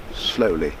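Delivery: ordinary delivery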